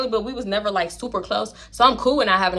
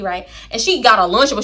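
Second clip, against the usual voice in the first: ordinary talk, nothing marked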